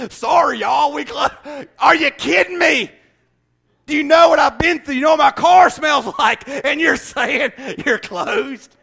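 exasperated comic shouting; mock outrage. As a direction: rant at the closed park gate. Acted angry dad at closed park.

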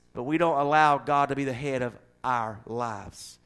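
ordinary talk, nothing marked

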